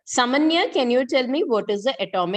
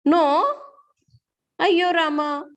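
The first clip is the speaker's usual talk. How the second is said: surprised dismay; 9 semitones higher, 3 dB louder than usual. Real, not acted. Teacher dismayed students never saw carbon.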